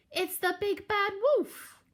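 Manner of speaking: very high panicked piglet squeal; frightened cry. A narrator's reading. Horace sees the wolf outside, frightened high cry. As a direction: squeal the warning in terror. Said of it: "cried Horace".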